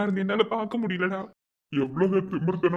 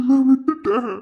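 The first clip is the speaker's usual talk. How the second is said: anguished cry; 5 semitones higher, 6 dB louder, slower than usual. Acted. Man alone crying out that he lost her, building from murmur to loud wail. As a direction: let it break open into a loud wail of loss.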